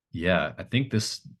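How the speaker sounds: usual speaking voice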